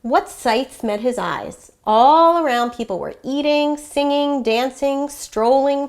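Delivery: animated, expressive picture-book narration; story read-aloud. A narrator's reading. Teacher reads a fiesta story to children, lively read-aloud.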